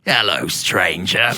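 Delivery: slow, low, shady trader; Resident Evil merchant. Acted. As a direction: drag it out low like a sinister game vendor. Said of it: "obsessed with how he speaks".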